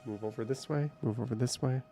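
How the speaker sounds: ordinary talk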